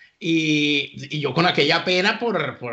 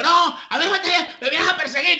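high-pitched retold outburst; outraged cry; 13 semitones higher, 3 dB louder than usual. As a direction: imitate the enraged man crying out. Acting the enraged man being followed.

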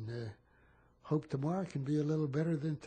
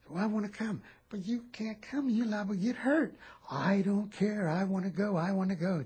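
lightly voiced boy, higher, insisting; a hint of a stubborn child; insistent kid; 4 semitones higher than usual. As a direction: kid refusing to stay behind, mildly voiced higher.

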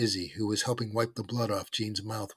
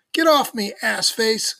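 high, loud outraged yell; angry cry; 12 semitones higher, 9 dB louder, slower than usual. A narrator's reading. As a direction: cry out furiously as if pinned down. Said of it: "cried Gene".

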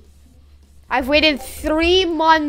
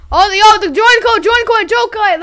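loud, high, frantic demand; high-pitched excited yelling; urgent shouting; 5 semitones higher, 7 dB louder, faster than usual. Real, not acted. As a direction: call out urgently for it. Urgently calls for the code.

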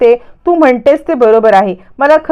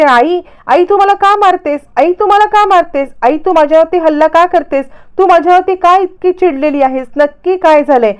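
high-pitched mock pleading to her mother; fake frightened plea; 7 semitones higher than usual. Daughter pretends to be attacked, calling to her mother in high-pitched mock fright. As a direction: put on a scared voice to frame her mother.

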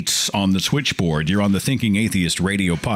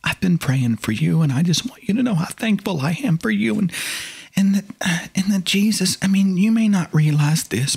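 sugary, much higher, condescending; condescending relative; 9 semitones higher than usual. Retold or imitated. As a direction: talk down sweetly like a pious aunt. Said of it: "give me that condescending look and say".

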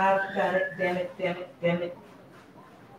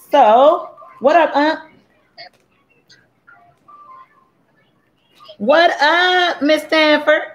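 cheery shout-out; 8 semitones higher, slower than usual. Real, not acted. Greeting a relative in the stream chat.

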